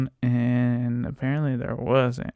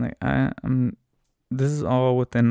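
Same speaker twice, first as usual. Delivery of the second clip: usual speaking voice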